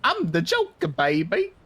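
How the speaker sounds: high cackling villain laugh; Joker voice. Acted. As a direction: cackle like a mad clown. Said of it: "I would be like the Joker".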